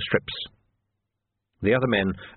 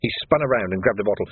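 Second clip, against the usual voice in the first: plain reading voice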